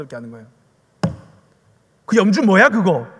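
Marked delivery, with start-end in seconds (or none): 2.0-3.1 loud, blunt mock scolding; imitating brusque evangelist. Evangelist confronting people from the temple, mock scolding. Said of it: "그 엄청 그 센 그 횟집 사장님 느낌".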